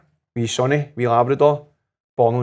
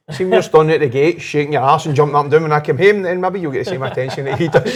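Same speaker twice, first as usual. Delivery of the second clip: louder, higher teasing retort, laughing at the end; cheeky comeback to wife; 4 semitones higher, 6 dB louder than usual. Retold or imitated. Husband teasing his wife about the dog, a louder, higher playful retort. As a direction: throw it back at her, half laughing.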